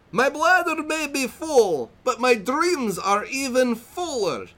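high, slow mock Borat accent; hammy Italian-Borat accent. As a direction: put on a silly Italian accent sliding into Borat. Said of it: "I'm gonna read it in the best accent that I can".

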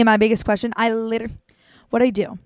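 ordinary talk

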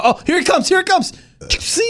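rising excited squeal. Real, not acted. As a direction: build up to a high squeal. Excited watching the bus ad.

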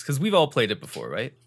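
normal talking voice

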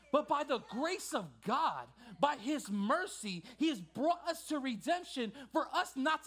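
loud, high preacher's cry, stretched key words; impassioned preaching. Acted. Preacher urging church to be the light, full-force preaching. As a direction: preach it with rising force to the last question.

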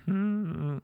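high, tight "hmm"; reluctant hum. Acted. Too polite to say no, high reluctant hum. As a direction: hum instead of refusing. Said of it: "Instead, I'd be like".

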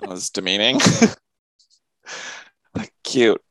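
ordinary talk

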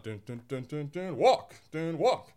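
hummed cartoon rhythm; lightly mock cartoon soundtrack; Bugs Bunny cartoon beats. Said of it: "my Bugs Bunny impersonation".

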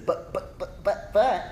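whispered stuttering "but but"; mock hesitant stammer; stammering excuse. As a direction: sputter out objections.

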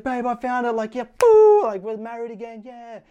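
high, loud happy squeal at babe and boo; excited. Retold or imitated. To his wife about the found ring, thrilled high squeal. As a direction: gush the news to your wife, rising high. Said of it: "I was so excited".

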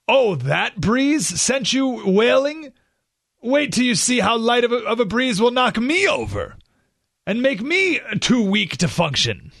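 high, exaggerated "wait till you see me" mockery; mocking one-upmanship. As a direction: parody someone bragging about how fragile they are. Mocks people competing over who's weakest.

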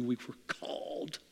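hushed, slow whisper; whispered conviction. Real, not acted. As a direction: let it drop to a whisper. Whispered climax.

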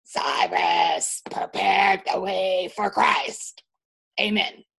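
solemn church testimony from a frail father. Retold or imitated. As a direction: deliver it like a weak but solemn testimony. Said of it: "and he goes".

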